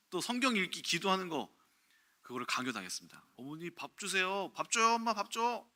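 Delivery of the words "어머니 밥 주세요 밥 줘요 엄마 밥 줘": put-on whiny child begging. Child begging mother for food.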